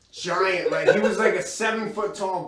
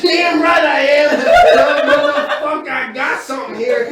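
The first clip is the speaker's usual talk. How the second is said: defiant shout; 8 semitones higher, 10 dB louder than usual. Retold or imitated. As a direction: yell it back with swagger. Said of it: "I said".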